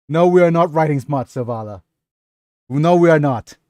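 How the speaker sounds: emphatic playful telling-off; firm scolding. Real, not acted. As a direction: shut it down loudly. Mock scolding a chat member, Zavala.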